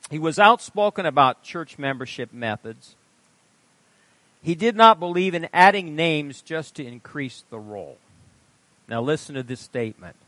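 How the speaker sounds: ordinary talk, nothing marked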